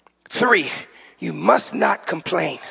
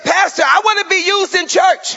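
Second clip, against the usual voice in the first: lady's plea; 10 semitones higher, 3 dB louder than usual. Retold or imitated. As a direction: voice her high and eager. Voicing the blinged lady.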